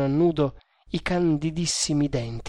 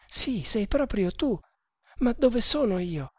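put-on higher girl's voice, overwrought; lightly acted tearful joy, pitched up; laughing and crying; 7 semitones higher than usual. A narrator's reading. Girl recognising her rescuer, mild laughing-crying emotion. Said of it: "ridendo e piangendo a un tempo".

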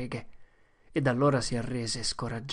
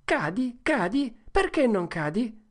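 higher, rising insistence; impatient boyish shout; 9 semitones higher than usual. Acted. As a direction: demand he fall. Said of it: "Tom gridò". (Tom grida impaziente durante il duello)

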